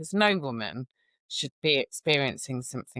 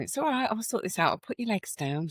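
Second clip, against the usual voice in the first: higher, gentle reassurance to a patient; reassuring nurse; 6 semitones higher than usual. Retold or imitated. Nurse retelling calming a woman after the doctor walked out, reassuring. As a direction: soothe her, it's handled. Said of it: "said to the woman".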